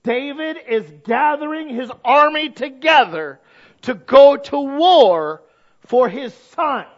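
loud, high, slow building sermon; emphatic slow pulpit preaching; full-force preaching. Acted. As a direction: preach it hard and drawn out.

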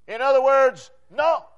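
usual speaking voice

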